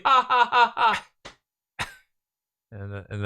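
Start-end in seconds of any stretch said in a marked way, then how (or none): 0.0-1.9 high, deliberate ha-ha-ha; hammed-up laugh in separate beats; mock villain laugh. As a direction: fake triumphant cackle. Acted ad character's mock laugh.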